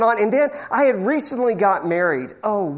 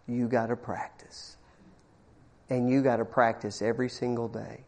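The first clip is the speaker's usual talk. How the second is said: low, soft, grave "You gotta practice"; solemn low advice; 7 semitones lower, 10 dB quieter than usual. Retold or imitated. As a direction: hand down the one true secret. Owner looks him in the eye.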